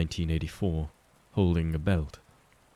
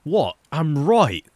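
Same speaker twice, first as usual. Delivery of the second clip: loud and much higher; louder, higher indignant yelp; indignant protest; 10 semitones higher, 8 dB louder, slower than usual. Acted. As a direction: yelp of injured innocence. William protests after head smack.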